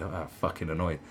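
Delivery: normal talking voice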